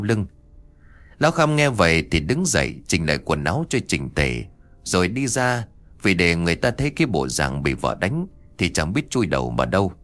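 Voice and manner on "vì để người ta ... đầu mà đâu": ordinary delivery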